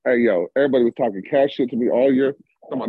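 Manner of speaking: ordinary delivery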